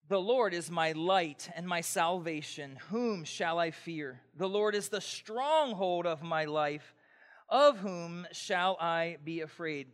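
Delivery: slow, solemn reading of the psalm; scripture reading. Acted. Pastor reading the psalm aloud to the congregation, slow and solemn. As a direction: read the verse slowly and reverently.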